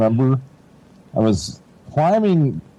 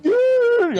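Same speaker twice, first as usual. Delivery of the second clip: very high, drawn-out shocked cry; shocked dude. Retold or imitated. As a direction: gasp it out seeing the wound to the bone. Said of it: "I couldn't even talk".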